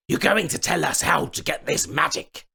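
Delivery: high sneering goblin voice; threatening goblin king. A narrator's reading. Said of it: "he said".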